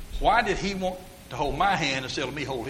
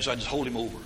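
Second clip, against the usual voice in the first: usual speaking voice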